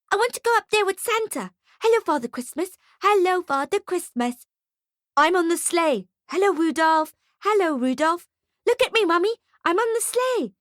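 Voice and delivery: put-on high, eager little-kid voice; excited children. A narrator's reading. Children clamber up to see Santa, excited and high. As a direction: squeal it like an overexcited child.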